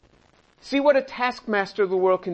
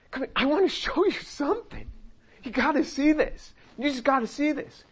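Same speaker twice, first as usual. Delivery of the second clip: excited invitation; 6 semitones higher, 3 dB quieter than usual. Acted. God eager, like a grandchild.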